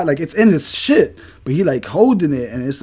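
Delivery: ordinary talk, nothing marked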